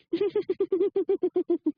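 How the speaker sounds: high, long put-on giggle; mocking giggle. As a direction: imitate a woman host tittering endlessly into dead air. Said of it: "She goes".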